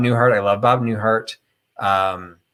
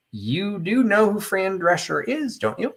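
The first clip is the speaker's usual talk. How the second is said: high, slow disbelieving question; incredulous; 10 semitones higher, 3 dB quieter than usual. Retold or imitated. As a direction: ask in mock disbelief. Mother and daughter reply incredulously.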